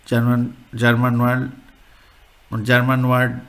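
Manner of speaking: ordinary talk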